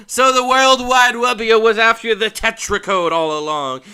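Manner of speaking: loud cartoon villain taunts and brave replies; hammed-up game dialogue. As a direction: ham up the boss-fight showdown. Hams up game dialogue voices.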